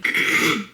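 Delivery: sharp cough. Real, not acted. Sick vlogger coughing.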